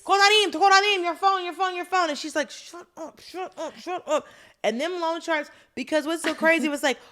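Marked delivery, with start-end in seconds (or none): 0.0-2.1 high-pitched, repeated hailing; eager shout, lightly acted; mock calling out. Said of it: "This man's gonna come up screaming". 2.5-4.3 high, hushed, repeated plea; acted-out secretive panic; urgent hushing. Heroine urgently hushing man shouting name.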